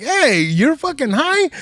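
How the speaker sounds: high, slow, accusing aunt. Retold or imitated. Aunt catching her stoned nephew, high slow accusation. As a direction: catch him out, drawn-out and high. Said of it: "My aunt looked at me like".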